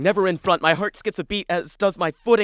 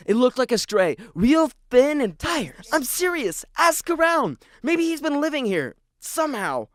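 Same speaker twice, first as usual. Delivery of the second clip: insistent; 7 semitones higher, 4 dB louder than usual. Acted. Insisting to the librarian.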